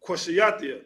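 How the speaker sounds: normal talking voice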